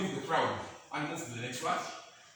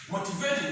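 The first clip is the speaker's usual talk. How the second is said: Motivating lifted high with a rising tune; stressing the word; 7 semitones higher than usual. Shown as a demonstration. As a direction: hit Motivating high and let it rise. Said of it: "mark the words I use".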